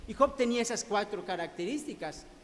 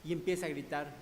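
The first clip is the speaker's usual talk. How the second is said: normal talking voice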